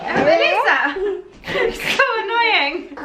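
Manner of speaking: slow, laughing exasperation; drawn out through giggles. Real, not acted. Laughing at sister.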